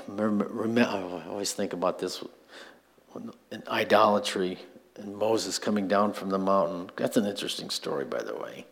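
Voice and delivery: normal talking voice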